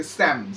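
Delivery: স্যাম said clearly as sam, no p, no l; clear pronunciation. Shown as a demonstration. Teacher showing students how to say স্যাম, slow and clear. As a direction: say স্যাম plainly as sam, p and l silent. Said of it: "এই উচ্চারণটা মনে রাখবে".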